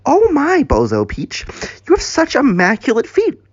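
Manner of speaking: high-pitched gushing; fawning admiration. Acted. Dougie admiring Bozo's feet, fawning.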